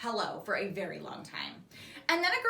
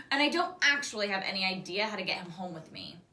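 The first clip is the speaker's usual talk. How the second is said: ordinary delivery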